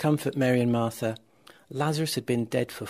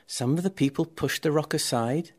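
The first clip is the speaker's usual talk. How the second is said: ordinary delivery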